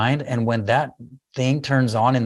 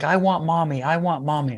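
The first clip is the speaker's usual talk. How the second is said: higher-pitched imitation of a child pleading; upset child; 4 semitones higher than usual. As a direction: lightly mimic a little kid's meltdown plea. Retold child melting down, wanting mother.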